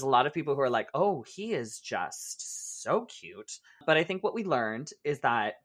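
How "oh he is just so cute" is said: slow mock swoon; drawn-out gushing. Quoting people smitten with the boyfriend, drawn-out gushing. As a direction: imitate admirers, stretching the words out.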